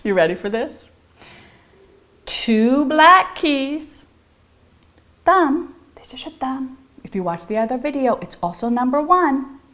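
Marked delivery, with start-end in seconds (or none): 2.3-5.7 slow, drawn-out singsong; weird rhyme voice. Acted. Teacher chanting her piano rhyme in a silly voice. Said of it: "that weird voice that I do". 6.0-7.1 breathy hushed aside; whisper. Acted. Teacher whispering which finger to use.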